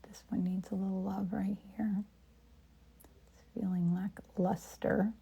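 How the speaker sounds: soft whisper to herself. Real, not acted. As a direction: whisper as if painting alone. Whispered painting narration.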